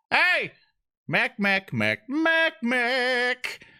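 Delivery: yelling out. Acted. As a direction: call after the departing ferry. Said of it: "I kinda go".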